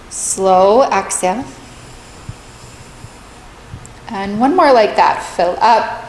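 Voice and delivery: calm, measured meditation voice with long pauses; guided meditation. Real, not acted. Meditation teacher leading listeners through a body scan, calm and spacious.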